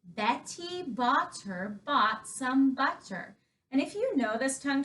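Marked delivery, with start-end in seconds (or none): 0.0-3.4 slow, clear first reading; slow demonstration. Shown as a demonstration. Teacher introducing the next twister slowly to children. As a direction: spell it out slowly for kids. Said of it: "the tongue twister we're going to do".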